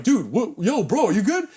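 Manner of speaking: high, startled check on a buddy; retold alarmed check. Checking on friend in retold fight.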